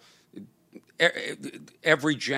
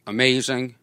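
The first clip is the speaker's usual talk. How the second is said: ordinary talk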